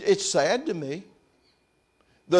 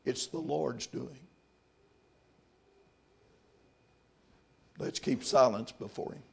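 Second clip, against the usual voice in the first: hushed reverence; 10 dB quieter than usual. Real, not acted. Preacher urging the church to keep silence before God, hushed. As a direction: drop to a reverent hush.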